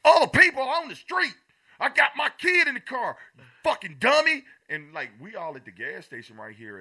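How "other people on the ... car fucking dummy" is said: high, loud mock road-rage yell; angry yell. Angry driver yelling at a reckless car, loud mock yell.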